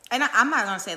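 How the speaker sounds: loud, high outburst; a sudden shout in the middle of a rant; sudden loud burst. Real, not acted.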